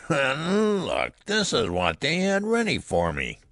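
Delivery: put-on very high, cackling old-man voice; malicious old man. A narrator's reading. Old newborn showing off the tiny garment, malicious. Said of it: "With a malicious cackle".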